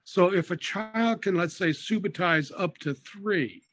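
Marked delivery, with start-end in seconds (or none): none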